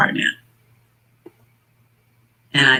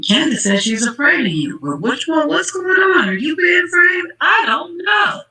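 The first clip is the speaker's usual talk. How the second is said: frantic rising shout; 11 semitones higher than usual. Real, not acted. Calling out to Donald, building to a frantic shout. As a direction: pitch climbs higher and higher to a pleading shout.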